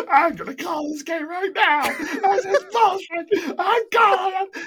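loud, high-pitched indignant cry; hammed-up protest through laughter; mock outraged protest. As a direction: imitate an outraged scorekeeper, voice cracking with laughter. Acts out outraged scorekeeper stopping game.

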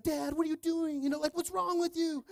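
put-on high, loud, indignant little kid; imitated outraged toddler; two-year-old son. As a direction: whine at Dad in disbelief. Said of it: "he's looking at me like".

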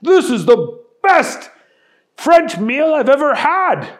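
lightly voiced excited celebrity chef; Gordon Ramsay delighted. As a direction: rave like a thrilled chef. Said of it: "said Gordon Ramsay".

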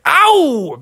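high "ow"; sudden story-time cry of pain; pained yelp. A narrator's reading. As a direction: yelp at sharp claws. Talons grab the bear.

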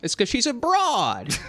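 mock old-timer voice. Joking in mock chauvinist voice.

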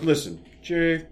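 soft, low, halting producer voice; Lorne Michaels. Retold or imitated. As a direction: placate him quietly with long pauses. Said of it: "And Lorne goes".

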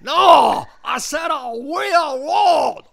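put-on high, exasperated accented shout; John Woo. Director repeating himself up the hill, screaming impression. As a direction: imitate a director bellowing, stretching the last words. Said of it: "John would scream from the bottom".

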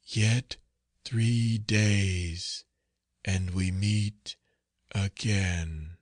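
drawn-out, sepulchral intoning; faint sepulchral voice. A narrator's reading. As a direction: stretch each word like a voice from the grave. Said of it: "faint, hollow, and sepulchral".

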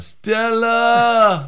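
loud, high yell of Stella. Retold or imitated. Friends riffing on a film, yelled Stella. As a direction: bellow it like Brando in the street.

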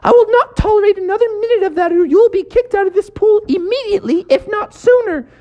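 high, fast scolding lifeguard voice; stern lifeguard. Acted. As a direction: scold him like a strict lifeguard.

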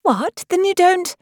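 high-pitched tiny creature; squeaky, questioning insect voice; little voice. A narrator's reading. Gnat starting to question Alice, in high little voice. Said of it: "the little voice began".

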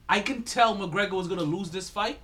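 normal talking voice

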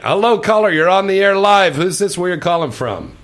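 loud, high on-air greeting; radio host voice. Acted. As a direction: welcome the caller like a DJ.

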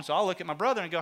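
usual speaking voice

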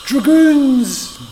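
high, loud drawn-out shout; mock battle cry. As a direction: holler it like a fantasy war cry. Shouted Thrones parody.

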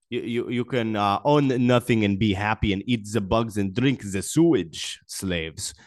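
a lightly put-on German accent, mocking a villain's speech. Vlogger mocking Klaus Schwab in a light German accent. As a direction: sneer a little in a hint of a German accent. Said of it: "an accent that literally no Germans I know actually speak like".

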